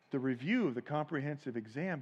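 usual speaking voice

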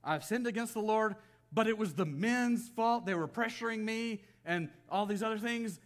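high, loud, protesting excuse-maker; Saul making excuses. Retold or imitated. Preacher mimics the king blaming his men, protesting excuses. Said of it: "If you remember Saul".

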